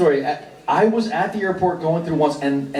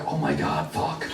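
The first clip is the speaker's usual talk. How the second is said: softer, dropping panicked aside under the breath; panicked mutter; 2 semitones lower, 7 dB quieter than usual. Retold or imitated. Comedian caught lying to the fan, muttered panic. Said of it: "And I was like".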